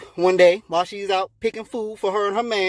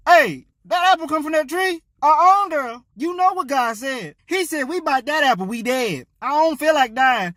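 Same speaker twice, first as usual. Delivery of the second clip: higher-pitched worried refusal; alarmed protest; 6 semitones higher than usual. Acted. Adam refuses Eve's apple, alarmed protest. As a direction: push back, voice raised in pitch.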